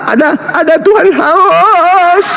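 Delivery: high, laughing, hammed-up groan of thirst; mock thirsty cry. Mocking Jesus's thirst on the cross, laughing and hammed-up. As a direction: ham up a dying man begging for water.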